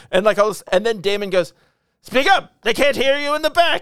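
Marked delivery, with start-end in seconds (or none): 2.0-3.8 imitating a loud, high-pitched public heckle; loud taunting call. Retelling a film scene to co-host, loud mocking call.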